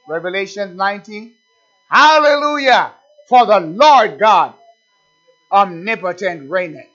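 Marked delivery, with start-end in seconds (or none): 1.9-6.9 saints' hallelujah. Acted. Preacher quoting the hallelujahs of Revelation, exultant proclamation. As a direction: proclaim it slowly, opening at full voice. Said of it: "Those are four hallelujahs".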